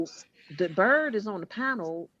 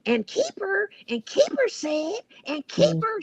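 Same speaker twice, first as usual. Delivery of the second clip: high, whiny mimicry of her calling Keeper; mocking her; 11 semitones higher, 4 dB louder than usual. Mocking the streamer who kept saying his name, high and whiny. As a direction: parrot her nagging in a high mock voice. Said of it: "she kept saying".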